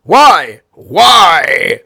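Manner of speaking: put-on slow, loud Italian accent, each why pushed harder; demanding Italian host. Host badgering Bob about Daniela, loud Italian-accented demands. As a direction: grill him in an Italian accent. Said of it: "Like that".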